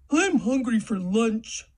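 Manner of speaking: goofy voice of a hungry creature. Shown as a demonstration. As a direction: whine about wanting lunch. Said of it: "say it in a voice to match who's talking".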